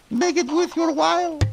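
very high squeaky femme fatale; falsetto lady voice. Acted.

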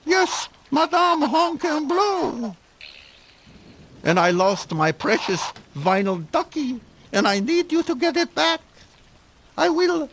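very high squeaky femme fatale; falsetto lady voice. Acted.

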